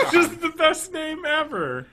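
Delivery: high, slow laughing words. Real, not acted. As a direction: laugh through it delightedly. Laughing delight.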